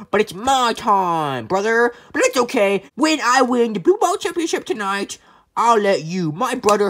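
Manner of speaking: normal talking voice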